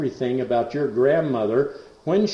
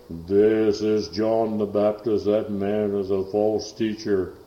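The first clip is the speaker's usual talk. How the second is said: slow, lightly voiced demon speaking through a woman; a hint of a menacing possessed voice, drawn out; possessed woman's man voice; 3 semitones lower, slower than usual. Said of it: "a man's voice come right out of a woman's vocal cords".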